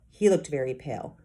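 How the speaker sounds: usual speaking voice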